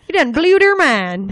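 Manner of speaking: loud, high, slow Southern drawl; Southern accent. Acted. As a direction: draw it out in a hammed-up Southern accent. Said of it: "Why did it have to go Southern?".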